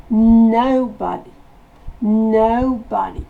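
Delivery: "Nobody" with a loud, bursting no; explosive no. Shown as a demonstration. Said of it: "On explose le no". ("Nobody" avec le no qui explose)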